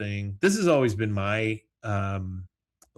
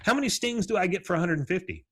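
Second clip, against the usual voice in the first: eager customer; 9 semitones higher, faster than usual. Retold or imitated. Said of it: "she walks up and she goes".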